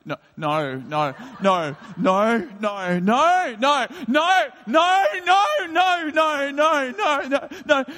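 retold panicked crescendo of no's; rising panic. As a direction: build the no's from murmur to yell. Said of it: "starts to just say".

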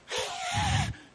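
a high, sharp hissing snarl; imitated hiss. Preacher imitating the hitchhiker hissing at him, high snarl. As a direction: hiss like something possessed. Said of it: "just hisses and snarls".

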